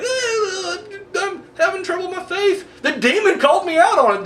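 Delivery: high, whimpering parody of a weak priest; hammed-up sobbing priest; mock whiny priest. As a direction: whine it out like a spineless exorcist. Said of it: "They're just like".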